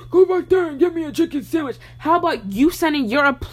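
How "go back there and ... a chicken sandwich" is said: high, mocking imitation of a rude customer; mocking demanding man. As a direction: ham up a bossy customer barking an order. Mocking rude grown men ordering.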